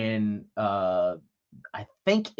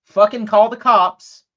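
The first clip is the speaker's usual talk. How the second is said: retold yelling back; 5 semitones higher, 6 dB louder than usual. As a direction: shout the retort back, high and loud. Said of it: "whom he was yelling at".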